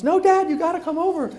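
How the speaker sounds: imitating the daughter on the phone; daughter pleading.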